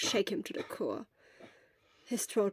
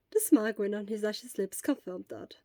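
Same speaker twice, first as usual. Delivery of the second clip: plain reading voice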